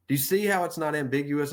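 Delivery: ordinary delivery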